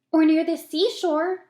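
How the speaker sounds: put-on high, loud, eager child; a boy dreaming of the seaside, eager and loud. A narrator's reading. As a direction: blurt it out full of hope. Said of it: "said James eagerly".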